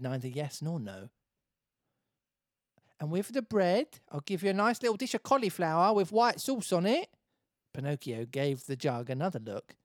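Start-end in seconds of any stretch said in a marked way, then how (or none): 3.0-7.1 higher, louder Essex lady; TOWIE Essex accent. Acted. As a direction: make the offer in TOWIE Essex. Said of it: "Can you make her towie".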